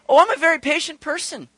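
high-pitched, mock-virtuous boast; self-satisfied claim. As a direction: act out claiming your own virtue.

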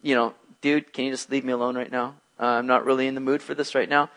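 too tired to deal with you; sick man wearily asking the visitor to leave; down in the dumps. Retold or imitated. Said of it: "and this guy's like".

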